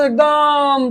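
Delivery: "एकदम" with a long held second syllable; drawn-out emphasis. Real, not acted. Teacher stressing the top degree, drawn-out emphasis. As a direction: stretch out "एकदम".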